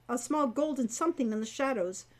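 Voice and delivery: usual speaking voice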